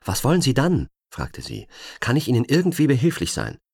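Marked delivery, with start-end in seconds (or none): 0.0-0.9 high, louder anxious female voice; anxious question. Acted. Agent nervously asks the stranger what he wants. Frightened woman questions intruder. 2.0-3.6 high, louder anxious female voice; anxious question. Acted. Agent nervously asks the stranger what he wants. Frightened woman offers help.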